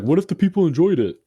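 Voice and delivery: high, loud mocking friend voice; imitating Jacob. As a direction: squeak it out as a goofy mock quote. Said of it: "Jacob's like".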